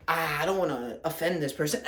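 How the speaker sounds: sneering take on a people-pleaser; mock meek voice. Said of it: "when you say".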